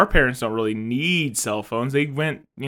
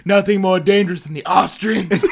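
put-on louder, higher Austrian accent; mock Austrian accent; 4 semitones higher, 7 dB louder than usual. Designers explaining the Terminator, mock Austrian accent. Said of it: "Why did he have an Austrian accent?".

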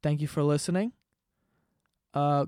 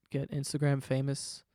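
ordinary talk

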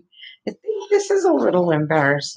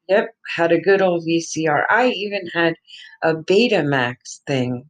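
ordinary talk, nothing marked

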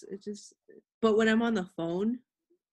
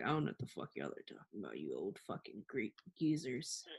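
low, rushed muttering under breath; quiet fast mutter; 10 semitones lower, 12 dB quieter than usual. Retold or imitated. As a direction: grumble it fast and low to yourself. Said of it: "I'm just like".

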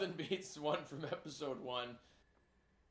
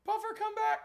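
calling out; 5 dB louder than usual. Real, not acted. Calling after a departed colleague.

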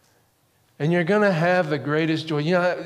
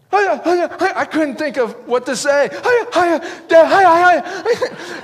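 high, laughing frantic cries, slowing at the end; a kid yelping while a fish drags him; panicked excitement; 13 semitones higher, 7 dB louder, slower than usual. Retold or imitated. Boy hooks a big salmon.

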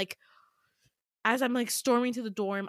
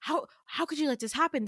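higher, stammered worried question; worried rambling; 6 semitones higher than usual. Acted. Damien questioning her about the scars, worried rambling. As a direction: fret aloud, tripping on the first word. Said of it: "super worried and just, like, rambling".

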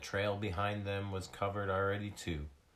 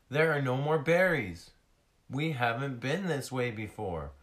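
high, loud, surprised; surprised discovery; 6 semitones higher, 7 dB louder than usual. Acted. Skyla finding the berry trail gone, surprised.

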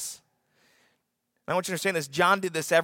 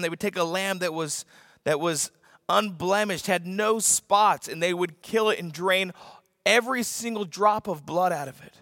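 normal talking voice

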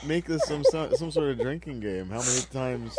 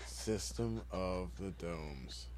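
announcer voice; 8 semitones lower, 13 dB quieter, much slower than usual. Pretend. Mock announcer title.

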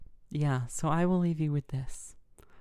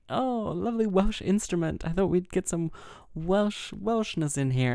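high, delighted gushing imitation; mock-delighted, lilting and higher; gushing organizer; 5 semitones higher than usual. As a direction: coo it like an enthusiastic event host.

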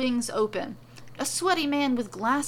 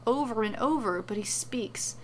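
plain reading voice

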